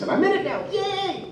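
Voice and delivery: very high, giddy little cheer; tiny high-pitched burst of excitement; fanboy squeal. Retold or imitated. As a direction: squeal with mock-childish glee. Acts out his fan joy at booking.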